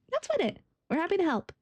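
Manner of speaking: high-pitched helper character; chipper companion. Acted. Companion offering the guard help, high-pitched eager voice. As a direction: pitch it well up for an eager reply.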